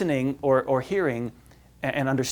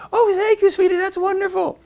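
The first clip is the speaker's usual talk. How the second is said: much higher, louder, sugary mock-mom voice; doting mom; 5 dB louder than usual. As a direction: play the gushing mother squeaking praise. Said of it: "And she's like".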